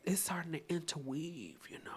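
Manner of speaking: whispered aside. Real, not acted. Confiding to viewers over the book, whispered. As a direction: breathe it out in a whisper.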